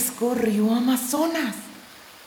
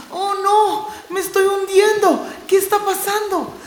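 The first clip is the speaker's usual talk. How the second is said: high, louder panicked cries; panicked cry; 11 semitones higher, 5 dB louder than usual. Acted. Core sinking in quicksand, high frightened cries. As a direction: scream for it to stop.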